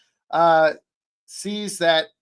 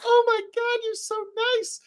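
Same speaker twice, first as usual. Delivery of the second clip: very high-pitched mock gush; imitated girl, far above own voice. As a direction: squeak it out as an overwhelmed girl. Retold gushing girl, about to cry.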